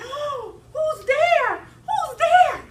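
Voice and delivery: high, loud scared cry; lady calling into the dark basement, terrified; frightened cry. Acted. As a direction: call out in fear.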